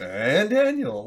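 slow, drawn-out announcer echo. Retold or imitated. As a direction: stretch the name like a show host. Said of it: "Well done".

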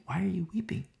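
soft unvoiced whisper; a tender angel's whisper; whispered angel question.

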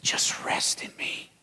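slow hushed whisper; whispered voice of God. Retold or imitated. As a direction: breathe it slowly like a divine whisper. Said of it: "and said".

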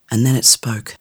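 ordinary delivery, nothing marked

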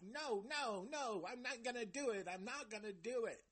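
high-pitched mock refusal; childish tantrum. Reviewer mimicking Mike refusing to talk, childish tantrum. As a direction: imitate a whining child refusing. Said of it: "acting like a, a fucking little girl, a child".

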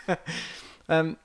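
usual speaking voice

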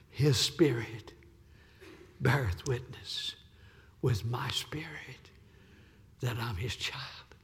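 slow, fading from soft voice to a whisper; fading scripture whisper. Acted. Preacher quietly recites Romans to the congregation, whispering. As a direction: let the verse die into a whisper.

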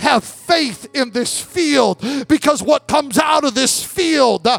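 slow, shouted revival sermon; full-force preaching. Acted. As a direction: preach it to the whole church.

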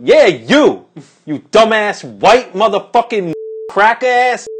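slow, high, loud drawn-out insult; imitated angry screaming. Woman by the car cursing out the narrator, screaming. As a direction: yell it hard, leaning on each word. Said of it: "like, screaming".

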